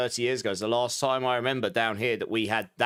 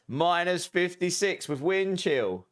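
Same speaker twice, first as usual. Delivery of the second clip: reading chat aloud; 6 semitones higher, slower than usual. Acted. Reading a viewer's temperature.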